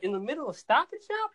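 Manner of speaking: mock-shocked little sister; disbelieving sister. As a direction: sister can't believe he's recording in the store. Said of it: "And she's like".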